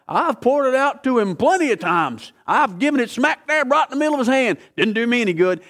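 high-pitched, acted-out protest; worked-up mock grievance; exasperated complaint. Voices a frustrated believer's complaint.

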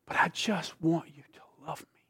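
soft unvoiced whisper. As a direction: breathe it as a secret longing. Voicing God's longing, whispered.

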